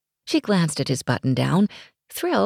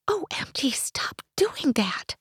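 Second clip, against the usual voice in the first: softer scolding whisper to herself, slowed; hush-scold her own blushing; silent reprimand; 9 semitones higher, 4 dB quieter than usual. A narrator's reading. To herself about the Scottish stranger, whispered self-scolding. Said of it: "she silently reprimanded".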